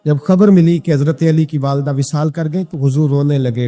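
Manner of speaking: preacher calling the crowd to listen, forceful; impassioned preaching. Acted. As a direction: preach it out to the whole gathering.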